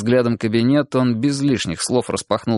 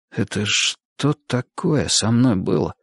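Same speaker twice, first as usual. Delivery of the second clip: puzzled inner thought; slower than usual. A narrator's reading. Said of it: "с недоумением подумал".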